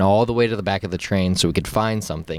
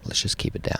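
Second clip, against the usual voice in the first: low hushed voice, nearly whispered; acting out a discreet word to a friend; hushed plea; 3 semitones lower, 6 dB quieter, faster than usual. Hushing friend in quiet car.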